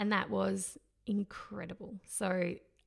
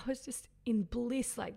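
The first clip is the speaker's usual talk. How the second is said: ordinary delivery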